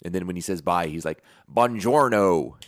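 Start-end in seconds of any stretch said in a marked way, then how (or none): 1.5-2.5 loud, drawn-out bad Italian accent; terribly faked Italian farewell; Brad Pitt. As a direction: butcher the Italian with a strong American accent. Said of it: "It just was terrible".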